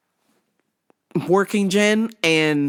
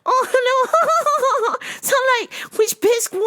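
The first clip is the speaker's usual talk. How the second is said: very high, exaggerated Valley Girl voice; hammed-up squealing Valley Girl impression; mock Valley Girl accent. Coworker mocking a lady she dubs Miss Swan, mock Valley Girl accent. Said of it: "Miss Swan with a fucking Valley Girl accent".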